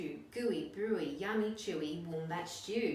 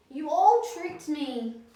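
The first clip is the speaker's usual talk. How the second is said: much higher, louder, slow howl; howling dingo; 10 semitones higher, 13 dB louder, slower than usual. A narrator's reading. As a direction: wail it like a poisoned dog. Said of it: "he howled".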